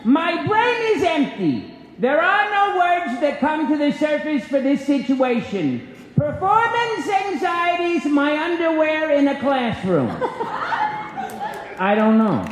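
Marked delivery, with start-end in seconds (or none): 0.0-10.1 high, frantic nasal-free goofy comic voice; Jerry Lewis. Acted. [name] reading the script for the crowd, as Jerry Lewis. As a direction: play it like a flustered Jerry Lewis. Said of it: "in the voice of Jerry Lewis".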